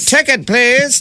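very high, slow ticket collector call; ticket taker. Retold or imitated. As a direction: drawl it out like a theatre usher.

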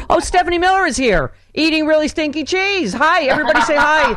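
loud, high mock announcement; warm-up comedian on mic. Warm-up guy outs his friend to the studio audience, mock mic announcement. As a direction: imitate an announcer calling someone out to the whole crowd. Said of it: "he literally on the mic is like".

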